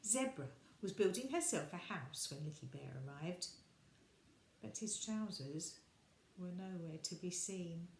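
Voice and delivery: usual speaking voice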